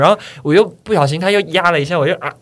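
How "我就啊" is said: ordinary delivery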